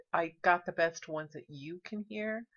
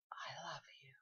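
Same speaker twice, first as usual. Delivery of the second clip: soft unvoiced whisper; spirit whisper; 5 semitones lower, 17 dB quieter than usual. Vlogger imitates the ghost saying it, whispering. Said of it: "you'll hear it say".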